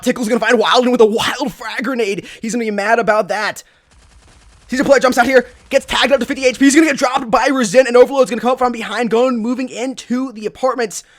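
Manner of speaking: loud, high esports caster call; live play-by-play. Real, not acted. Caster calling a firefight for viewers, excited play-by-play. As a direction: call the action with rising urgency.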